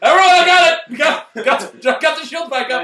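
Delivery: shouted like a silly crewman; mock triumphant crew member. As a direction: ham up a proud officer fixing the shields. Mocking the bridge crew.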